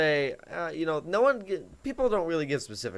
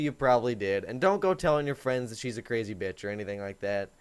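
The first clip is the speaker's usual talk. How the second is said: ordinary talk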